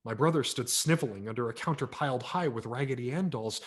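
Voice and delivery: plain reading voice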